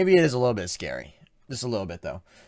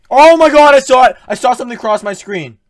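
scared shouting; 8 semitones higher, 16 dB louder than usual. Real, not acted. Saw something scary.

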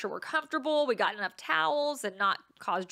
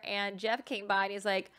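normal talking voice

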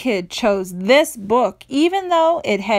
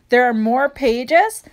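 plain reading voice

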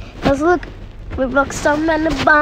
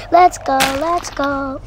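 ordinary delivery

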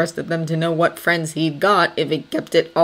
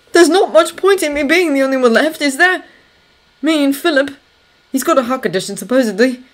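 higher, louder, bitter and worked-up; agitated Thomas; 9 semitones higher, 6 dB louder than usual. Acted. Thomas telling Anna about being left behind, agitated. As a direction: argue it out with raised voice.

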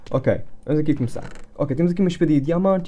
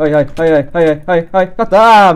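loud panicked ai ai ai, rising at the end; frantic cries; 10 dB louder than usual. Real, not acted. Player crying out loud during a frantic fight. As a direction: shout them out in a frenzy.

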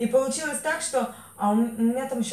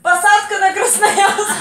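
high, loud, slow, laughing through it; laughing shout; 9 semitones higher, 12 dB louder, slower than usual. Retold or imitated. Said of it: "Говорю".

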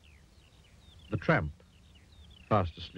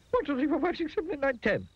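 very high, hurried little voice; nervous counting. A narrator's reading. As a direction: count quickly to calm your fright. Panicky Jelly counts to calm down.

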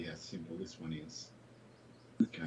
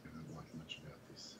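whispering; 3 semitones lower, 7 dB quieter than usual. Real, not acted. Muttering doubts over the shot.

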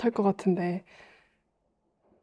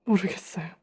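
real near-whisper, soft and breathy; hushed; 5 dB quieter than usual. Real, not acted. Interviewee unsure why that day comes back, hushed. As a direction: let it out almost voiceless after hesitating. Quiet answer after long hesitation.

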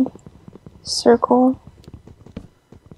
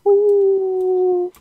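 high playful sing-song cry; gleeful whee; 8 semitones higher than usual. Real, not acted. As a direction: shout it like a fairground ride. Playful cry while falling.

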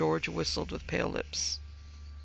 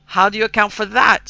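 loud, higher, dreadful demand; awful voice; 6 semitones higher, 12 dB louder than usual. A narrator's reading. Mother confronting father about the veil, awful voice. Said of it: "said Missus Townsend in an awful voice".

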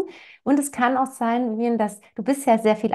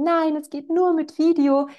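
higher-pitched mock child protest; imagined protesting child; 8 semitones higher than usual. Four-year-old refusing to sleep without video, acted higher-pitched protest. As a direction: imitate a child objecting, voice lifted higher. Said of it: "dann wird er vielleicht sagen".

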